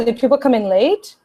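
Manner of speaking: ordinary talk, nothing marked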